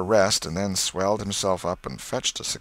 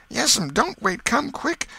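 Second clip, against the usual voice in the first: very high, frantic plea; urgent, high-voiced boy; panicked urging; 4 dB louder, slower than usual. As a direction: hurry her along in alarm. Sid urges aunt upstairs.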